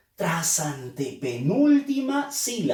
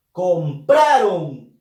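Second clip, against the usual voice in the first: "compraron" lento y fuerte, alargando "pra"; acento en penúltima sílaba; 7 dB louder, much slower than usual. Shown as a demonstration. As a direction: carga la voz en la "pra" de "compraron". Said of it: "su fuerza de voz está en la". ("compraron" con la sílaba "pra" bien marcada)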